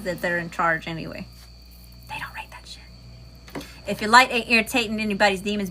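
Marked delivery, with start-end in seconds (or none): 2.1-3.6 slow, hushed whisper; very soft, drawn-out whisper; whispered aside. Real, not acted. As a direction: whisper it slowly, like a secret dig.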